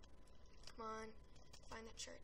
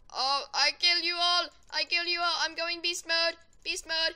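hyped shouting; 3 semitones higher, 12 dB louder than usual. Real, not acted. Gamer hyped during the match.

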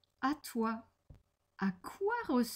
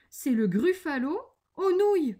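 louder, higher, with relish; playful menace; 7 semitones higher, 6 dB louder than usual. Acted. The mouse names its favourite dish to the gruffalo, relishing menace. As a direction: announce the dish with mock threat.

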